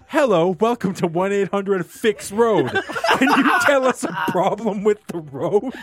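slow, high robotic phone greeting laughing through; automated phone voice. Retold or imitated. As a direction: imitate a recorded hotline, giggling. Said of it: "an automated voice goes".

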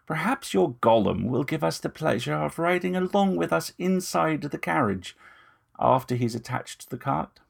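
lightly voiced vampire gentleman, smooth and ingratiating; a hint of menace under courtesy; vampire purring false reassurance; Moust, oily vampire. Moust invites the golem.

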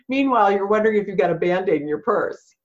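ordinary talk

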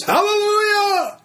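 very high, drawn-out hallelujah cry; imitated scream. Preacher imitating a healed man at the meeting, high screamed hallelujah. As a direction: belt it out like the man screaming, slightly toned down. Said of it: "began to scream at the top of his lungs".

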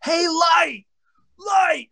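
high, loud yell across the field; retold scream. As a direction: call out to Light from the dugout. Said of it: "he's screaming".

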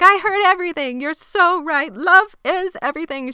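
high, loud imitation of gushing; mock overjoyed outburst; gushing agreement. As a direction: pounce on it with hammed-up excitement. Retold Missy gushing.

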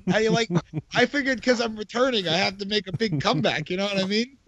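normal talking voice

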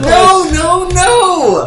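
high, loud panicked cries. Real, not acted. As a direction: yelp in mock panic. Creature flies toward them.

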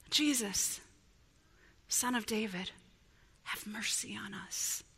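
soft, sinking prayer, fading into a whisper; hushed plea. Acted. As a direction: plead quietly, almost under the breath. Acted plea drops to whisper.

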